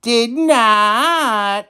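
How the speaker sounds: put-on high childish squabble back and forth; high squeaky kid voices arguing; arguing children. A narrator's reading. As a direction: bicker like little kids. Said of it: "argued the children".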